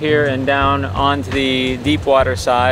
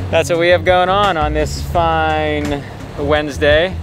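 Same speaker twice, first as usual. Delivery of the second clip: ordinary delivery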